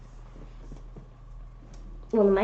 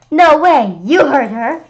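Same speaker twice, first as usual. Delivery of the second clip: loud, higher outraged yell; defiant yell; 6 semitones higher, 10 dB louder, slower than usual. Acted. Kid shouting at the monster, loud outrage. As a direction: yell in protest. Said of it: "I yelled".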